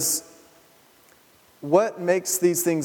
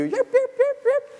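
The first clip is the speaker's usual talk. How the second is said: very high yapping toy dog; toy puppy yips; slower than usual. Retold or imitated. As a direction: yap like a tiny battery puppy.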